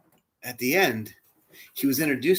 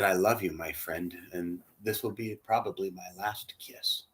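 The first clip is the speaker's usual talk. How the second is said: soft, fading tender farewell; 6 semitones lower, 9 dB quieter than usual. Retold or imitated. Man says goodbye to his dying mentor, fading softly. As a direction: let it fade to almost nothing. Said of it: "I said".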